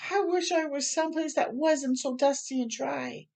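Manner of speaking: whiny wish. Acted. Girl in sandstorm.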